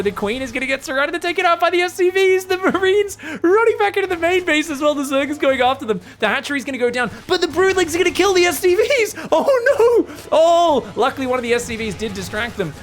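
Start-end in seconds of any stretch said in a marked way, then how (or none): 0.0-10.9 very high, loud caster hype; frantic play-by-play. Real, not acted. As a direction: build up to a wailing cry of disaster. Live battle turning against the player.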